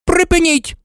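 high-pitched referee's cry; narrated shout, no real bellow; shouted order. A narrator's reading. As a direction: cut the players off with a high call. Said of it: "крикнула мадам Гуч".

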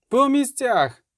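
high-pitched commander's cry; barked order. A narrator's reading. Райла ordering soldiers to positions, high-pitched cry. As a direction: call out the order sharply. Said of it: "грикнула Райла".

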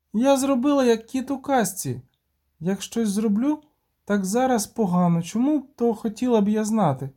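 put-on high-pitched child's grumble; complaining little girl. A narrator's reading. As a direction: protest in a high girl's voice.

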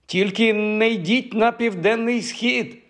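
high, drawn-out call; slow, high-pitched calling out; calling after someone. A narrator's reading. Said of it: "гукнула їй услід Софі".

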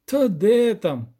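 high, drawn-out lament; long-drawn groan of dismay. A narrator's reading. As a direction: moan it slowly and loudly. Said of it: "простогнав Кропивник".

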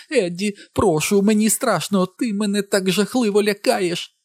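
frightened, wheedling woman's voice; babbling, pleading wife. A narrator's reading. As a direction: beg him to stay, pitch rising as panic builds. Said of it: "лепетала вона".

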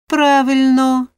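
high, loud, drawn-out approval; approving agreement. A narrator's reading. Carpenter backing the man at the window, hearty approval. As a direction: chime in slowly and loudly. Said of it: "підтакнув тесляр".

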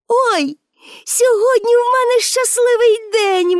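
put-on high, excited girl's exclamation; high-pitched burst of joy; delighted Pippi. A narrator's reading. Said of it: "аж сяючи з задоволення". (радісний дитячий вигук)

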